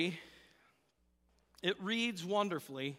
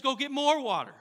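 raised, louder order; acted command; 6 semitones higher, 6 dB louder than usual. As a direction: send them off with a firm order. Acts Elijah ordering more water.

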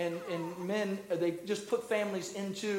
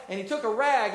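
ordinary delivery

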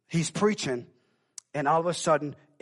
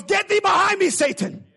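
high, loud commanding shout; rebuking shout; 13 semitones higher, 11 dB louder than usual. Retold or imitated. Youth pastor rebuking the pastor over the dead woman, loud commanding shout. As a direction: command the pastor back like Satan. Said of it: "So he goes".

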